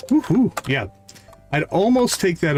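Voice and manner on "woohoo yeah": normal talking voice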